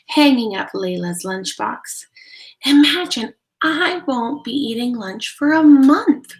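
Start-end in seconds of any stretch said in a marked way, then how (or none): 2.7-6.3 put-on higher and louder, delighted girl; gleeful child. Layla twirling with joy to her mother, excited. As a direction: cheer it like a twirling kid. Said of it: "replied Layla with a twirl".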